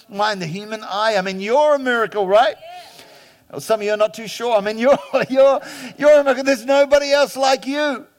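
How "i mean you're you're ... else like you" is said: louder, higher preaching peak; rising fervour. Real, not acted. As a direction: build the congregation up.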